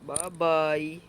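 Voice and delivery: ordinary delivery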